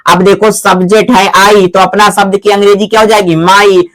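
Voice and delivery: ordinary talk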